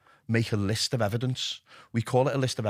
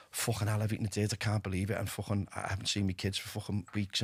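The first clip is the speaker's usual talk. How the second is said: guilty inner voice; 4 semitones lower, 8 dB quieter than usual. Retold or imitated. Guilt voice in his head.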